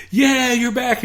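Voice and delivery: high-pitched crowd cheer, imitated; mock cheering. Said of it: "they're cheering".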